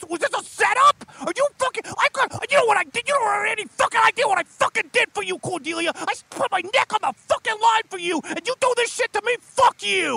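high, fast angry tirade; demon fury. Acted. Demon boss yelling at betrayed Cordelia. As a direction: spit every word at her.

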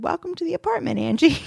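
high, playful cat voice. Retold or imitated. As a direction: voice the cartoon cat. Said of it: "it said".

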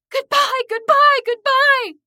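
desperate high-pitched scream, very loud; yelling with all strength. A narrator's reading. Narrator fighting off the ghoul, yelling with all their strength. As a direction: scream it over and over at full force. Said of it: "yelling with all my strength".

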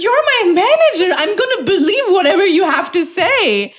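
high retold complaint with a laugh; indignant protest. Retells protesting to manager after prank.

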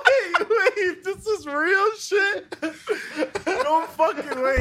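high-pitched, gasping with laughter; incredulous laughter. Real, not acted. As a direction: squeal in disbelief while cracking up. Helpless laughter at the revelation.

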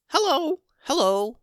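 high, bright squeaky hellos; delighted little critters trying out their voices; guinea pig voices. Acted. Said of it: "a couple more would say".

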